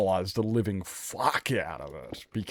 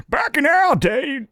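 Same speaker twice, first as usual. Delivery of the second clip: put-on much higher, louder and slow old man with an odd accent; old guy with an accent; 9 dB louder, slower than usual. Old man lecturing Nevada on the good old days, put-on accent. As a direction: croak it out like a grumpy old timer. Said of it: "the old guy had an accent too".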